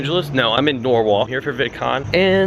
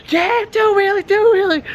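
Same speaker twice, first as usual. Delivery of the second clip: very high-pitched mock cheer, louder; cheering holler; 4 dB louder than usual. Buddy across the street urging wheelies, high cheering holler. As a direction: imitate a buddy egging on riders from afar.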